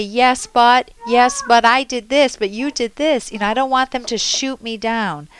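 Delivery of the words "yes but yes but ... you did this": louder, higher defensive protest; mock defensive retort; defensive yes-but. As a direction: push back, get defensive. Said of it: "I want my partner not to say".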